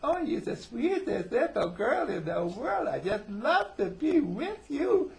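loud, high, slow mock baby voice; baby talk. Husband to wife as if to a little girl, mock baby talk. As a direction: gush it in drawn-out, hammed-up baby talk. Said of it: "baby talk like that".

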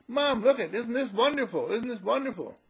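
put-on high-pitched child's delight; acted kid bubbling over; excited little boy. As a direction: show off to Mom, high and eager. Voicing boy showing off mud.

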